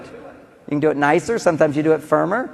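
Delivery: normal talking voice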